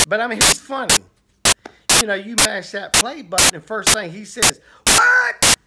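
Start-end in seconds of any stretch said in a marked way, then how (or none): 4.9-5.6 very high, excited catchphrase voice; high-pitched mock outcry at the top of the range; Paul Begley impression. Minister imitating Paul Begley's video opening, high and exclaiming. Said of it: "You know, that's how it sounds".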